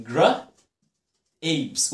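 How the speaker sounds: "gra-، apes" in two parts, gr then long-a apes; word split into sounds. Shown as a demonstration. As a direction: break it as "gra-", pause, "apes". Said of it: "وناس كتير بتنطقها غلط".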